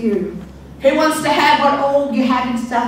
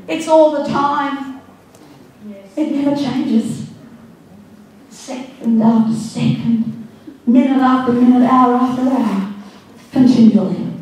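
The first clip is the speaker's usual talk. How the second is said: normal talking voice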